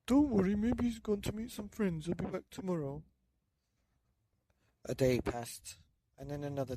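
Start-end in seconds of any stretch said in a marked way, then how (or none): 0.1-3.0 put-on high, louder old man voice; old man comforting. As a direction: reassure her, high and light.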